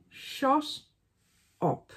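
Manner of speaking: "Shut" rising high, then a flat "up". Pretend. Scolding herself with a high rising "Shut". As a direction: pitch "Shut" up high and let it rise.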